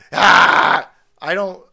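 ordinary talk

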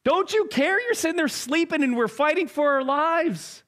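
high, indignant pleading; exasperated plea. Acted. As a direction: accuse him of not caring. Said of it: "I love how Mark says it".

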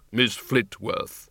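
grave, measured figure of Death; Death's voice. Acted. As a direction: speak as Death, calm and unhurried. Windle/Death slow reply.

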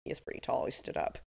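whispered, grudgingly impressed. Retold or imitated. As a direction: breathe it out, reluctantly impressed. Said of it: "she's kinda like".

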